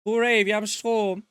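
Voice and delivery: delighted the lights work; cheering. Acted. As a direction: celebrate like a kid. Cheering power comes on.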